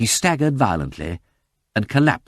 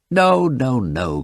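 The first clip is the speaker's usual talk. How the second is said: cried protest; 5 semitones higher, 4 dB louder, much slower than usual. Acted. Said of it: "cried the detective".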